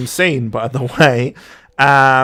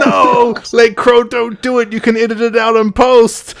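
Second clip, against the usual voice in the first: high, raised melodramatic begging; mock desperate pleading; 8 semitones higher, 4 dB louder than usual. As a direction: plead as if stopping a friend, hammed up. Mock pleading after friend's joking threat.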